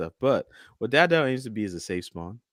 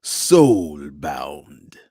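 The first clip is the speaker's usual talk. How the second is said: deep, loud, drawn-out fighting-game announcer; Mortal Kombat announcer; 6 semitones lower, 6 dB louder than usual. Shown as a demonstration. Host showing off his best impression, booming game announcer voice. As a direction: boom it out to open the fight. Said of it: "my best Mortal Kombat announcer voice".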